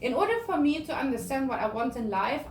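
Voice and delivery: usual speaking voice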